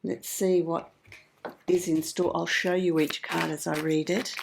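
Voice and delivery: croaky voice, still recovering from flu; hoarse voice. Real, not acted. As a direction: talk through a flu-worn throat. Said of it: "Excuse my voice, I have had the flu".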